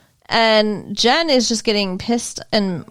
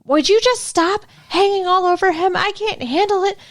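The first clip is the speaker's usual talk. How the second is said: higher-pitched retold jealous rant; mock loud outburst; 7 semitones higher than usual. Retelling her telling off the waitress in the dining room, mock outburst. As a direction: imitate a possessive public outburst, voice pitched up. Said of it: "all loud in the dining room".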